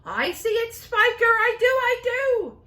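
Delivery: high, loud excited chatter; thrilled high cries. Acted. Aunts crying out as the peach speeds up. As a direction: gush it out up high.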